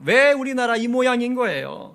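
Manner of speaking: high, loud protest at God; a prophet arguing loudly with God; demanding challenge. Retold or imitated. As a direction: confront him, demand an answer. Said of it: "하나님께 따진 거예요".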